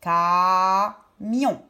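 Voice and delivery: syllable-by-syllable "Camion"; split into syllables. Shown as a demonstration. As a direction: hold "ca", pause, add "mion". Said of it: "Je prends la première syllabe".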